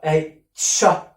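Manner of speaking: normal talking voice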